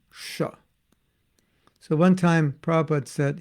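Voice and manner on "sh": "sh" sound shown on its own; a hushing "sh" in place of S. Shown as a demonstration. Teacher showing how Bengalis say S, "sh". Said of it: "the S's are S H".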